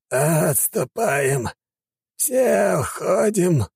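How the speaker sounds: slow high hoarse call to retreat; hoarse shout. A narrator's reading. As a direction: rasp it out, wounded. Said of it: "прохрипел он". (хриплый крик)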